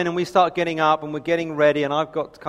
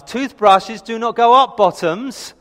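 higher, louder, slow mock telling-off; imitated parent's emphatic decree; scolding parent; 6 semitones higher, 5 dB louder, slower than usual. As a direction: spell out the rule like an exasperated parent. Acted parent scolding the boys.

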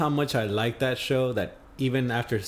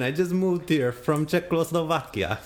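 lightly put-on Eastern European accent, a bit higher; mock Eastern European accent; 7 semitones higher than usual. Podcast host signing off to listeners, mock Eastern European accent. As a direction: hams up a vague Eastern European accent to sign off. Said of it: "I'll sign off with a little accent".

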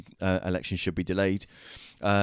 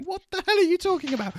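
very high, squeaky mock exclamation; high-octave outburst; 4 dB louder than usual. Co-host admits he nearly challenged the other, high-octave mock outburst. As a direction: send it way up the octave in mock disbelief. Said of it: "possibly in that octave".

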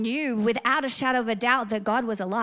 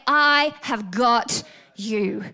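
I HAVE GOT YOU drawn out, very slow and loud; emphatic promise; 5 dB louder, much slower than usual. Acted. God there for the retreating believer, slow and emphatic. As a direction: land each word of "I have got you" separately.

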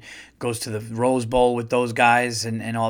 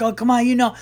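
high, loud, impatient prodding; acted game-night urgency; imitated urging; 11 semitones higher, 5 dB louder, faster than usual. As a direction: coax a guesser who nearly has it.

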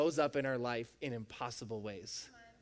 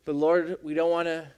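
earnest, louder prayer to the Father; closing prayer; 6 dB louder than usual. Acted. Pastor praying over the church to close the series, earnest. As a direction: lift it up as a sincere prayer.

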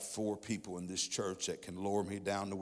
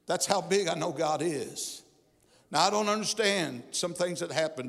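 rising, loud, higher preacher's climax; preaching at full force; 6 semitones higher, 6 dB louder than usual. Acted. Preacher telling the church of his daughter's healing, full force. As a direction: pour it out on the church, building to a shout.

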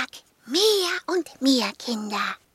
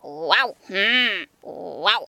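lower, woofing dog voice; 6 semitones lower, much slower than usual. Acted. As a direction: woof it like a big dog. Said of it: "meinte Sokrates".

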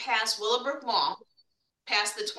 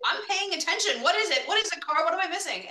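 higher, louder mock exasperation at the beeping car; exasperated questioning of car; 8 semitones higher, 3 dB louder than usual. Guest talks back to his beeping car, higher and louder in mock exasperation. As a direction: plead with the car, baffled and annoyed. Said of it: "But I'm like".

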